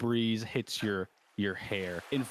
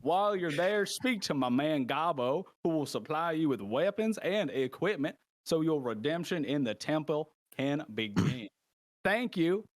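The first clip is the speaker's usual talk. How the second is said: higher, drawling Southern host; strange Southern man; 8 semitones higher than usual. Acted. Host sends the criminals to the jungle camp, Southern host voice. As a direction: give instructions as a showy Southern announcer. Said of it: "that strange southern man".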